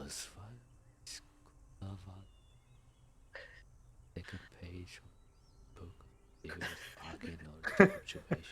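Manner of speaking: soft murmured sleep-talk. Acted. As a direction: mumble it half asleep. Sleeping elf murmuring spells.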